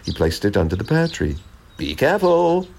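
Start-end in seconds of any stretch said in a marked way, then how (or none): 1.8-2.7 high, mildly urgent caution; warning. A narrator's reading. Robin watching Jack climb the footstool, warning. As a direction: pipe up a word of caution. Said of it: "warned the robin".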